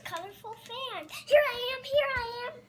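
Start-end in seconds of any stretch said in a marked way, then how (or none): 1.3-2.6 higher and loud, bursting in; excited little puppet calling out; eager puppet entrance. Pretend. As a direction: pop up shouting here I am.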